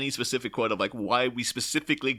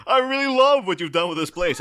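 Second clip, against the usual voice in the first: loud, high, theatrical gush; sudden showy outburst; 7 semitones higher, 7 dB louder than usual. Retold or imitated. Riddler taking over Two-Face's room, loud and showy. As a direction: burst out big, playing to the whole room. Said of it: "And then instantly into".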